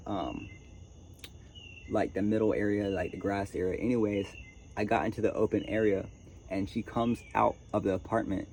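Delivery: ordinary delivery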